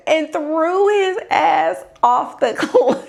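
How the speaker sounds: slow, breaking into laughter; real laugh breaking in; cracking up. Real, not acted. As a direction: start laughing before the last word. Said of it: "I'm crying".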